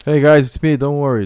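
loud friendly hail; calling out. Real, not acted. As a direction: call across so they don't shoot. Calling out to other players.

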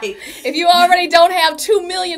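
loud, high, laughing through it; loud laughing sarcasm; laughing mockery. Real, not acted. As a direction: poke fun at follower counts. Mocking social media worthiness.

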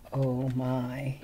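ordinary delivery

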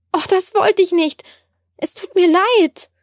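high, stricken apology; deeply shocked. A narrator's reading. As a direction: gasp it out, horrified at what you did. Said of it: "sagte ich zutiefst erschrocken".

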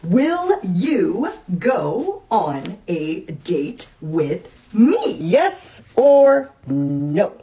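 drawn-out, very slow reading. Acted. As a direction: stretch every word out. Reading the note slowly.

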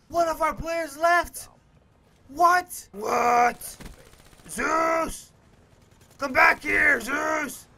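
loud, high, incredulous yelling; hyped shouting. Real, not acted. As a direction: yell after a teammate who left. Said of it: "I'm getting a little hyped".

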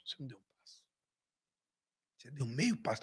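very soft, low correction; low-key, understated rebuke, retold; imitated deflating father. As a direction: quietly put the proud son in his place. Acts out father deflating his pride.

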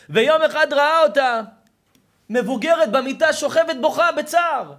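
high, full-force storytelling cry; thundering preacher's narration; impassioned shouting. Real, not acted. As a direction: proclaim the tragic moment at the top of your voice. Preacher cries out story's climax.

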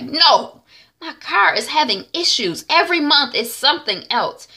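high, loud, emphatic complaint; vent, fed up with the car; exasperated venting. Retold or imitated. T Y vents about broken car.

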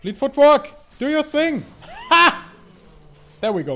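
laut und hoch anfeuern; begeisterter Ruf. Real, not acted. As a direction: schrei die Rune begeistert an. Feuert Rune im Kampf an. (laut, hoch, begeistert anfeuernd)

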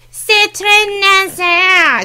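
high, drawn-out nasal Quebecois French; hammed-up Quebecois accent, way up in the nose; mock Quebecois twang, very slow and high. Shown as a demonstration. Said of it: "It's, like, way up here".

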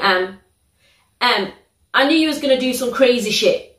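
normal talking voice

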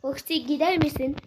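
usual speaking voice

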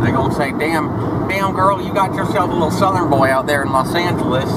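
playful fake Southern accent; mock Southern accent. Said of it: "I'm gonna talk like this the whole time".

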